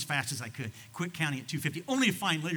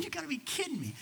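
laughing disbelief; 13 semitones higher, 4 dB quieter than usual. Retold or imitated. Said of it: "I'm laughing".

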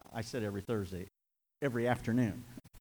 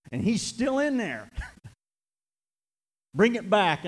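loud, high, slow cry of hope; preacher's rising hopeful shout; 8 semitones higher, 7 dB louder, slower than usual. Real, not acted. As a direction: lift it up into a fervent shout.

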